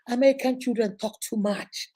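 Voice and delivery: ordinary talk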